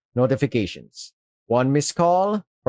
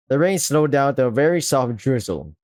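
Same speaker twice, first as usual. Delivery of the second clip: normal talking voice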